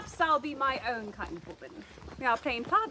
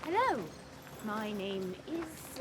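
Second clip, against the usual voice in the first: slower voice; 5 semitones lower, 5 dB quieter, slower than usual. Pretend. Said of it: "Talk slower".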